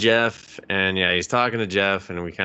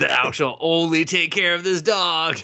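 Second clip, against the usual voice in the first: mock robot commandment; 8 semitones higher than usual. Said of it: "Thou shalt not hurt pup".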